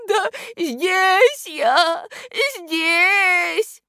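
high, slow, tearful wail; слёзы в голосе. A narrator's reading. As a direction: answer plaintively through tears. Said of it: "в голосе у этого невидимого слышались слёзы". (плаксиво, протяжно, со слезами)

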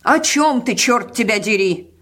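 put-on old woman's loud, high demand. A narrator's reading. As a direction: raise your voice in irritation. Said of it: "повысила голос старуха".

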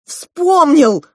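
loud, high cry of recollection; excited shout. A narrator's reading. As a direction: shout the answer in triumph. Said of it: "закричал Клоп". (радостный крик)

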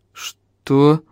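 blank, drawn-out question; stunned, slow to take it in; dumbly asked. A narrator's reading. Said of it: "тупо спросил Эльм".

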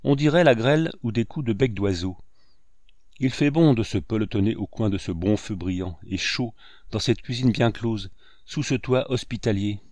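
ordinary delivery, nothing marked

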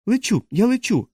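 high-pitched cry of wonder; gasped exclamation. A narrator's reading. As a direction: marvel aloud at flying, pitch soaring. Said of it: "ахнув Тімоті". (захоплений вигук високим голосом)

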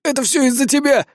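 muffled accusation. Acted. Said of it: "более приглушённым голосом".

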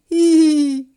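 high, loud mocking whinny; mocking neigh. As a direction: neigh like a jeering horse. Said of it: "издала издевательское".

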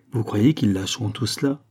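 plain reading voice

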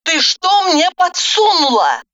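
high, mildly voiced angry demand; angry phone hiss. A narrator's reading. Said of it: "зло прошипела я в трубку". (зло, с упрёком)

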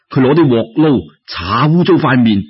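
ordinary delivery, nothing marked

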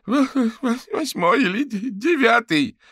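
high, loud, tearful stutter; terrified stammer. Acted. Fetisov tells Averin the attacker's level, stammering in terror. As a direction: stammer it out on the edge of tears.

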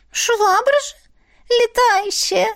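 high, bright little creature voice; proud otter voice. Acted. Otter proudly names herself.

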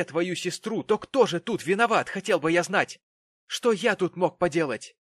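loud, high angry tirade; enraged father demanding answers; furious. A narrator's reading. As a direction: berate your daughter indignantly. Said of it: "пришёл в такую ярость".

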